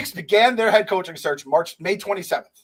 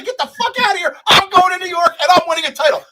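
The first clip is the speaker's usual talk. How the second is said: higher and louder, hammed-up bravado; mock defiant yell; 11 semitones higher, 8 dB louder than usual. Imagined coach telling Pat Riley off, mock shout. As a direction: bark it like quitting on the boss. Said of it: "And he tells Pat Riley".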